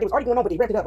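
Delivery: ordinary delivery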